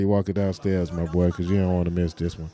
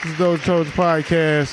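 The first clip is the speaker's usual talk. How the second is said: show intro; 7 semitones higher than usual. Acted. Host announces the show.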